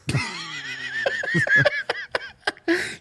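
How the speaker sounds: squeaky falsetto cackle; long high giggle fit; high-pitched giggle. Real, not acted. Laughing at crude joke.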